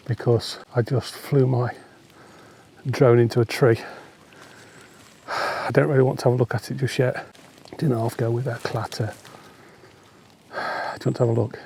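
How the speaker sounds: ordinary delivery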